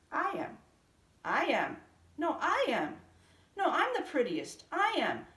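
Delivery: many cats each claiming to be prettiest; quarrelling cats. A narrator's reading. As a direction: bicker and compete loudly. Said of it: "cried the hundreds".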